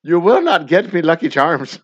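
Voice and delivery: very loud, hammed-up Irish warning; bad Irish accent. Father makes himself perfectly clear, in a bad Irish accent. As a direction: lay down the law in a cartoonish Irish brogue. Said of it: "affect a bad Irish accent".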